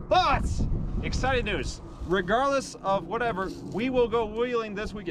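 excited planning. Real, not acted. Announcing weekend wheeling plans.

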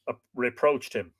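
ordinary delivery

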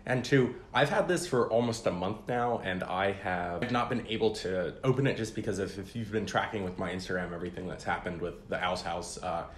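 usual speaking voice

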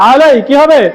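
usual speaking voice

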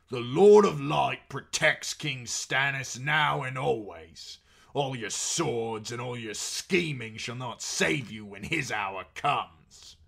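loud, slow, defiant proclamation; defiant warning. A narrator's reading. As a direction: proclaim it like a threatening prophecy. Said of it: "warned one".

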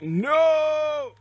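long, very high, loud "no"; anguished shout. Real, not acted. Gamer failing to save a teammate, anguished shout. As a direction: wail it out at full volume.

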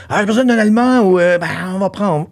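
high, loud mock announcement; acted casting call. European film-makers picking an actor, animated acted quote. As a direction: call it out high like an eager producer.

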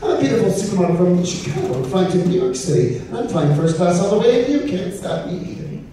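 high, haughty diva voice; snooty supermodel voice. Acted.

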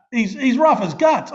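laughing. Real, not acted. Laughing about friend's face.

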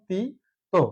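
ordinary talk

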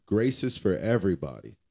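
normal talking voice

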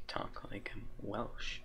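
whisper. Real, not acted. Whispered aside.

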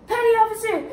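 mock crying. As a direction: imitate a recruit bursting into tears. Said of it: "he started crying".

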